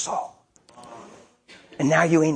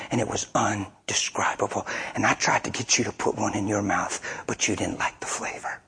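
tender hushed explanation; 4 semitones lower than usual. Retold or imitated. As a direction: confide it gently like a secret.

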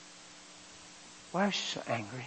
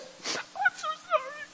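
much higher, tearful voice of a weeping wife; crying wife; 5 dB quieter, much slower than usual. Retold or imitated. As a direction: sob it out as the wife. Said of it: "she was crying so hard".

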